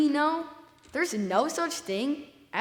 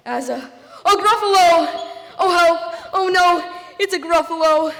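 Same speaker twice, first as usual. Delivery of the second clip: panicked shriek; 7 semitones higher, 10 dB louder than usual. Acted. Mouse sees the real gruffalo.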